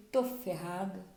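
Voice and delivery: hushed, low inner monologue; Zacchaeus's dismayed thoughts. Acted. Zacchaeus dreading condemnation for his wrongs, quiet dismay. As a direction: worry under your breath, acting out a guilty man. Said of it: "O que que Zaqueu sentiu?".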